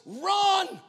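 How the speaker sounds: high, loud cry of run; inner voice shouting. Retold or imitated. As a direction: shout it like a warning from the soul. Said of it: "and that word was".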